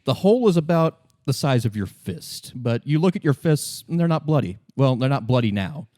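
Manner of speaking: usual speaking voice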